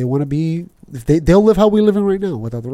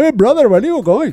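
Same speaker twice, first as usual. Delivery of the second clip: tough cab driver; 9 semitones higher than usual. Retold or imitated. Taxi cab greeting a rider, hammed-up cabbie. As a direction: hail them like a pushy cabbie. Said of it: "I want my taxi cab".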